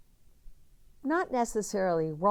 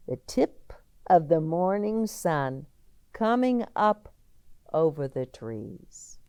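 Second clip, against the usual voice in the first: ordinary delivery, nothing marked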